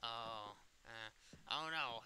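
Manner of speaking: ordinary talk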